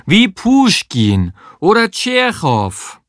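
high, drawn-out exclamation; exclaimed retort. A narrator's reading. Character tossing out Russian writers' names, high and drawn out. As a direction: stretch the names out, swooping high. Mocking exclamation before shouted line.

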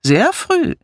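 high, reproachful clip; reproachful remark about the heating. A narrator's reading. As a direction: tut it out reproachfully. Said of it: "fügte vorwurfsvoll hinzu".

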